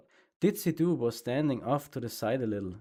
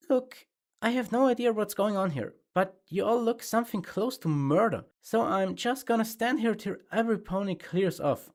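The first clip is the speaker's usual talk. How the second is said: higher, firm and steady; lightly voiced narrator pony standing firm; firm Rose; 9 semitones higher than usual. A narrator's reading. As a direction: hold your ground without yelling. Rose confronting the elements.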